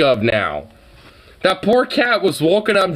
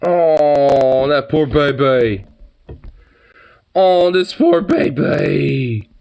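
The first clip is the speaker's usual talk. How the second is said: slow, drawn-out pitying coo; 3 dB louder, much slower than usual. Real, not acted. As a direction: feel sorry for the hurt kitty. Pitying scratched cat in photo.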